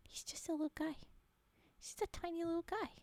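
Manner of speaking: tender whispered coo; whispering. Real, not acted. Whispered cooing at monkey photo.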